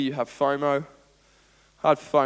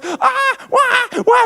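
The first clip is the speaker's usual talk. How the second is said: high, loud mock wailing; imitated baby crying; 13 dB louder, much slower than usual. Preacher playing another crying baby, high loud wail. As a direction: shriek out a newborn's cry. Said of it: "it's another baby crying".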